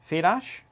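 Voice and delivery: "Fedash" as FAY-dahsh with a rising, unsure end; trying a name. Shown as a demonstration. Unsure how to say a viewer's name, trying "Fedash". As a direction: test out "Fedash" with a long AY vowel, as a question. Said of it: "or Fedash?".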